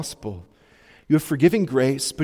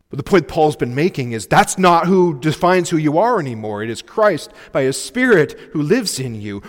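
louder, driving sermon climax; preaching at full force; 3 semitones higher, 5 dB louder than usual. Real, not acted. Preacher rallies the congregation to press on, loud and fervent. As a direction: urge the flock on, ringing.

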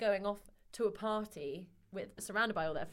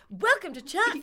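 loud, high-pitched tagline shout; enthusiastic ad sign-off; 10 semitones higher, 12 dB louder than usual. Acted. Shouting the tagline at the end of the ad, enthusiastic. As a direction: belt out the slogan. Acts the ad's rousing sign-off.

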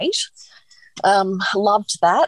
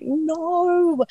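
very high, stretched "no"; retold dismayed wail; 12 semitones higher than usual. Told she is fully dilated, high mock wail. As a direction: re-enact dismay, pitched right up without shouting.

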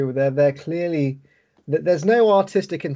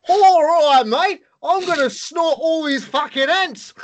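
much higher, hammed-up Australian accent, slower on the last line; mock Australian accent; 13 semitones higher, 6 dB louder, slower than usual. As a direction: overdo the Australian accent like a bad actor. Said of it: "He's got, like, an Australian accent".

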